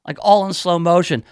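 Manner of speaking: usual speaking voice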